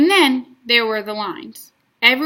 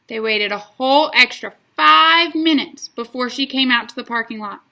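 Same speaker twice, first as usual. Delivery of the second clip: ordinary delivery, nothing marked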